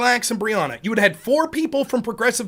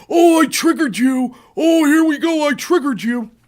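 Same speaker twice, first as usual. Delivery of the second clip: imitating her gloating taunt, a touch louder; mocking taunt; 4 semitones higher, 4 dB louder than usual. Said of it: "mocking her taunt".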